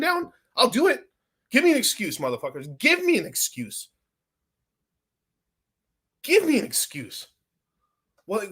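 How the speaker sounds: fed-up yelling at the audience; exasperated rant. Real, not acted. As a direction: vent at the chat like they never listen. Streamer ranting at inattentive chat.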